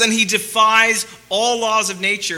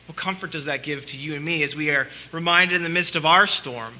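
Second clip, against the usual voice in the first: ordinary talk, nothing marked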